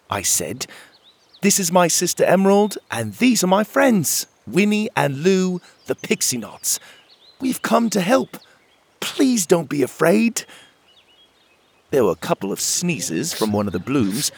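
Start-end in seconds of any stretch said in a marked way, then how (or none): 1.4-10.5 friendliest voice. A narrator's reading. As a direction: greet the hidden fairies as kindly as you can. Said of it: "friendliest voice".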